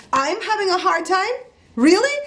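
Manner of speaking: high-pitched, incredulous comeback; indignant disbelief. Retold or imitated. Customer repeating the cashier's remark, high indignant disbelief. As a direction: throw the words back high, as an outraged question. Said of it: "So I, I lost it".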